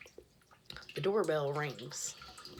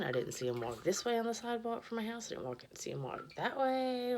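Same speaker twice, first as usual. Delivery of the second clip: ordinary talk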